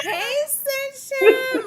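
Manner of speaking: very high, loud, drawn-out hello; gleeful greeting. Real, not acted. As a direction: squeal it back long and singsong. Host echoes guest's greeting back.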